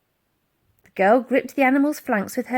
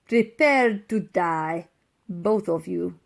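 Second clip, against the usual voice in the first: villain threat; 2 semitones lower, slower than usual. A narrator's reading.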